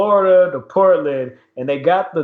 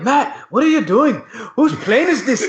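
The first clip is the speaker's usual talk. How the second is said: high, baffled imitation of a protest; mock alarm; 7 semitones higher than usual. Wrestler questioning his partner in the plane, mock alarm. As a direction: act out alarmed disbelief, pitched up. Retold alarm at climbing pilot.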